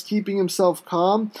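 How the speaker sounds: ordinary delivery, nothing marked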